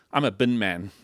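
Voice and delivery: low, understated job announcement; deadpan reply. Retold or imitated. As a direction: drop it low and matter-of-fact. Acted answer to what do you do.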